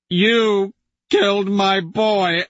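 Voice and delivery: a father's grief breaking out; anguished cry. Acted. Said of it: "voice breaks under the strain".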